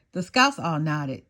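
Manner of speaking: ordinary delivery, nothing marked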